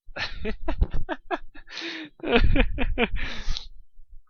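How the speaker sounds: high giggle at own slip; real laugh. Real, not acted. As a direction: break into a giggle. Laugh after misread line.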